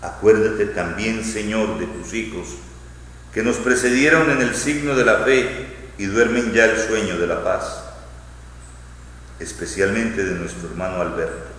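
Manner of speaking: ordinary talk, nothing marked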